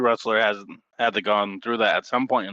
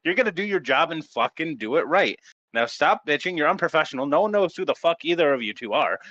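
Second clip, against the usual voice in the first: heated, higher-pitched rant; angry scolding; 5 semitones higher than usual. Real, not acted. Co-host telling off complaining wrestlers, angry scolding. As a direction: tear into them for being unprofessional.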